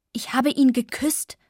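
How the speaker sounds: heated outburst. A narrator's reading. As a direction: blurt it out hotly. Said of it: "rief ich hitzig".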